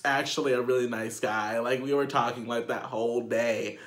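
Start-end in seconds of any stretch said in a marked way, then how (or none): none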